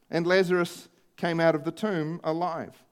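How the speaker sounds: ordinary talk, nothing marked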